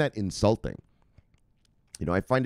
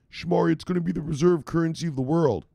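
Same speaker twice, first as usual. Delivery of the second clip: mocking the naive fans; 8 semitones higher than usual. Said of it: "they look at me like I'm stupid".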